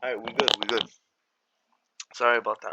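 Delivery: ordinary talk, nothing marked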